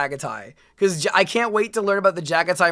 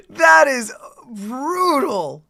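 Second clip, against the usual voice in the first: slow, awed and raised; excited; 5 semitones higher, 5 dB louder, slower than usual. Real, not acted. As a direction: savor how brutal it is. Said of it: "said again".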